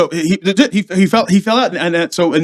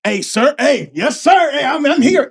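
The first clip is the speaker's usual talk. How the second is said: high-pitched, eager acted response; eager reply; 9 semitones higher, slower than usual. Junior Marine answering officers on the run, eager. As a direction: report in keenly, pitch jumping up.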